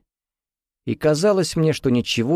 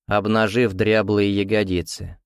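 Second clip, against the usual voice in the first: ordinary delivery, nothing marked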